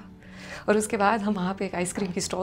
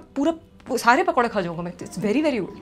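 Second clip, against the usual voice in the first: higher, louder gushing; overeager fake praise; 4 semitones higher, 6 dB louder than usual. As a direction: gush to cover the bad taste. Boy overly praising bad pakoras. (ज़बरदस्ती तारीफ़, ऊँची और ज़ोर से)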